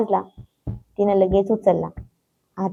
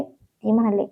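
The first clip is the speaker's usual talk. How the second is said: plain reading voice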